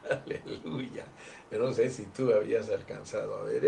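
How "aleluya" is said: soft breathy laughing aleluya; laughing whisper. Real, not acted. Joyful aside after point. (aleluya susurrado entre risas)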